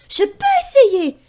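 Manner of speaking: put-on high, loud confident boy; firm boy. Pierre answers the bird, high and loud. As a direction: insist like a stubborn kid. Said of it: "affirme Pierre".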